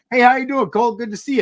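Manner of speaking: high, loud friendly hello; light up as you greet the clerk by name; cheerful name greeting. Shown as a demonstration.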